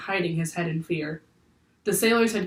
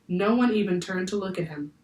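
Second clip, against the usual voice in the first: ordinary talk, nothing marked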